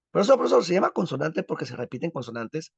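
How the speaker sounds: mock student voice, starting high; eager student. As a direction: pipe up like a pupil checking an answer. Acts out a student asking the teacher.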